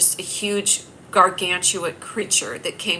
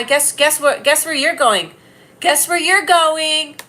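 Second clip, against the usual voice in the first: much higher and louder, drawn out slowly at the end; teasing taunt; 7 semitones higher, 6 dB louder, slower than usual. Pretend. Sending a commenter to timeout, a high loud playground taunt. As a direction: sing it like a playground tease.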